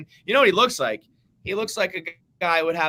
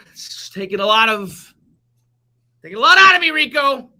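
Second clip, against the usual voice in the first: much higher and louder, hammed-up pained wail; mock anguish; 8 semitones higher, 11 dB louder than usual. Mets dad telling Rico the Yankees betrayal is killing him, a hammed-up wail. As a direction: wail it like it's a great tragedy.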